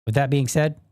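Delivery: ordinary talk, nothing marked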